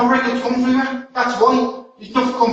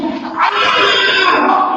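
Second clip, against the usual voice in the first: high, drawn-out "Ah!"; real pained cry; 7 semitones higher than usual. Real, not acted. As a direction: cry out while being held down. Restrained man cries out in struggle.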